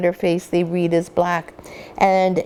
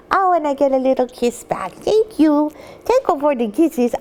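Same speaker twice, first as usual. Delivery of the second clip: puppy talk; 5 semitones higher than usual. Real, not acted. Thanking puppy for kisses.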